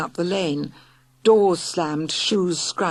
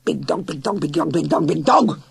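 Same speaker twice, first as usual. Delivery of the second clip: dog's growl; 4 dB louder than usual. Acted. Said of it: "Hector growled".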